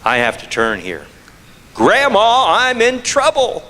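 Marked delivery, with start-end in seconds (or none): 1.7-3.6 high-pitched pleading call for help; frantic grandson. Retold or imitated. Grandson calling grandma in a panic.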